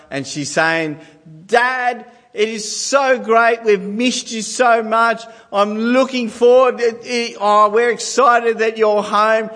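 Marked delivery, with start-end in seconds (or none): 1.5-9.5 put-on high, slow, sugary child voice reading a welcome note; daughter's voice. Father voicing his daughter's welcome sign, high and sweet. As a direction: play the gushing little girl. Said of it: "a sign on the door from my daughter".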